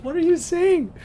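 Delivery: high, bewildered squeal; a young anglophone baffled by kitchen French; mock confused squeak. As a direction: play your past self utterly lost. Retold confusion at the cook.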